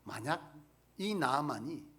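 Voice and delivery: ordinary talk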